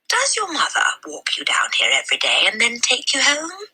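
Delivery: high, breathy librarian voice; a concerned lady asking gently; kindly librarian. A narrator's reading. Mrs Phelps voiced by narrator.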